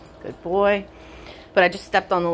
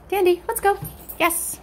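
dog praise voice; 13 semitones higher than usual. Real, not acted. Trainer praising her dog Tandy, high sing-song praise. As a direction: coo delighted praise at a good dog.

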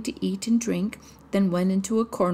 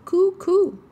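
much higher, louder cooed pigeon call; pigeon cooing; 12 semitones higher, 7 dB louder than usual. Acted. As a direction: coo it like an excited wood pigeon. Wood pigeon speaks.

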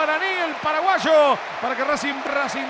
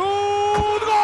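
goal call; 5 semitones higher than usual. Real, not acted. Commentator calling a goal.

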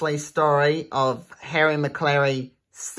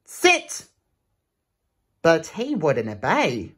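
lively rhythmic read-aloud; reading a rhyming book to children; storybook reading; 2 semitones higher than usual. Acted. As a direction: bounce through the rhyme for kids. Reads picture book aloud.

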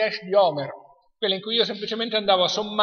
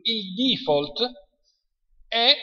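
ordinary talk, nothing marked

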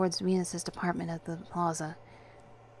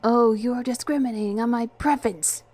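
higher, louder indignant protest; affronted, voice raised; defensive; 4 semitones higher, 6 dB louder than usual. A narrator's reading. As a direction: object defensively, trying to shame them. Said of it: "he said defensively".

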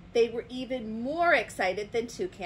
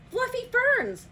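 high, loud, thrilled exclamation; excited discovery; 7 dB louder, slower than usual. A narrator's reading. Toucan spots ferns on his way home, excited exclamation. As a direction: announce a marvelous find.

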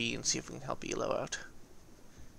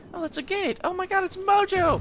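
high squeal building much louder; astonished recognition; 8 dB louder than usual. Real, not acted. Gamer spotting his clanmate Mojo as enemy, astonished high shout. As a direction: burst out in delighted disbelief. Discovers the enemy ship is friend.

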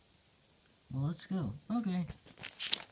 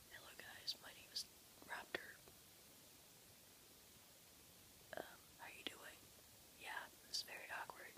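hushed, shy whispering intro, much softer; mock awkward old self; 21 dB quieter than usual. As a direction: whisper it like a nervous first video. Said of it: "I don't sound like".

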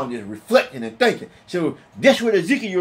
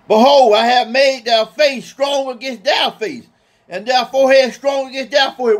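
solemn scripture reading; 3 semitones higher, 4 dB louder than usual. Acted. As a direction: intone the verse with weight. Reads scripture to congregation.

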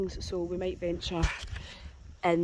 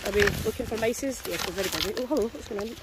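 usual speaking voice

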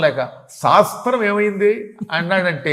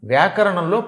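usual speaking voice

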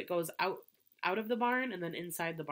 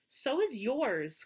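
crying out; 6 semitones higher, 3 dB louder, slower than usual. A narrator's reading. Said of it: "cried Avery".

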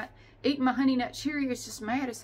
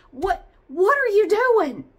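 loud, slow, high exasperated demand; exasperated yell; 10 semitones higher, 13 dB louder, slower than usual. Real, not acted. Ranting at people dawdling at the ATM, loud and slow.

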